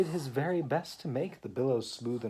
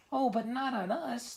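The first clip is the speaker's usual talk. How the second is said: alarmed oysters; 4 dB louder than usual. Acted.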